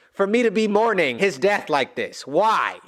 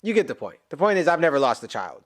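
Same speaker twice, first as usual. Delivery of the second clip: ordinary talk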